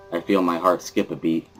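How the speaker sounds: usual speaking voice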